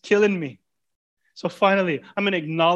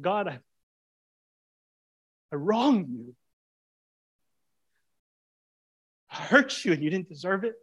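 humble confession; 2 semitones higher, 3 dB quieter than usual. Pretend. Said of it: "You ever talk like that to the Lord?".

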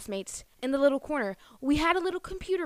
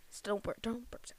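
ordinary talk

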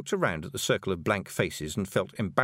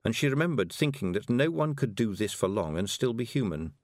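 plain reading voice

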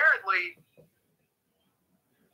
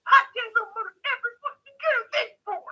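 much higher, squeaky mocking voice; mocking Jim Cornette. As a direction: sneer it in a high silly voice. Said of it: "In fact, he's like".

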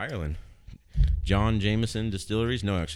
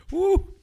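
loud high whoop after swallowing; whoop from whiskey burn; 8 dB louder than usual. Real, not acted. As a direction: yelp from the burn of liquor.

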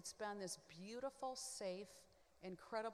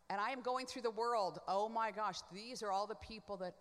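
normal talking voice

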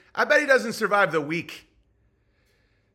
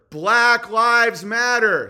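slow and louder, every word hit; slow emphatic slogan; 2 semitones higher, 6 dB louder, much slower than usual. Shown as a demonstration. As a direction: chant it slowly like a rally slogan. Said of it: "Say it with me, everyone".

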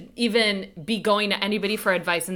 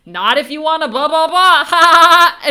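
loud, high forced ha-ha-ha; fake laughing; 7 semitones higher, 10 dB louder than usual. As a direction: ham up a fake laugh to dodge the subject. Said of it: "he starts fake laughing".

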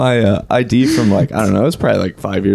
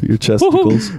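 high mock voice; 9 semitones higher than usual. Mocking the silly voice.

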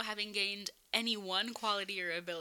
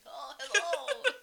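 high, slow put-on elderly voice; old-person voice; 7 semitones higher, 4 dB louder, much slower than usual. Imagining herself two hundred years old, old-person voice. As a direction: play a very old person, half laughing. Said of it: "think old people all sound like".

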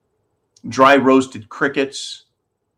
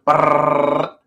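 buzzing lips drum roll; playful mouth drum roll; 2 semitones higher than usual. Pretend. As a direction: trill it like a snare drum. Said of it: "drum roll".

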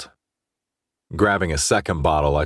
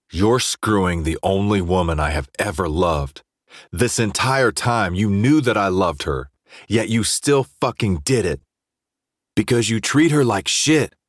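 angry, building; 4 semitones higher than usual. Acted. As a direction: push harder with every line. Drunk quarrel with friend.

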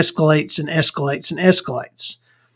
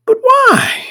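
very high mock-tearful squeal; Colin Farrell; 4 dB louder than usual. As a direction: whine it in falsetto, like a hurt friend. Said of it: "And Colin Farrell's like".

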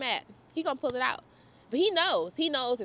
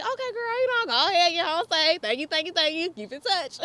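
cheery; 7 semitones higher, 4 dB louder than usual. Retold or imitated. Cheery goodbye to friend.

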